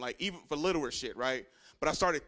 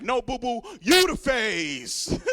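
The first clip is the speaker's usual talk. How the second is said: higher, slow drawn-out put-down; sassy sister; 6 semitones higher, 7 dB louder, slower than usual. Retold or imitated. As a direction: sister yelling a teasing comeback. Sister yells from the back.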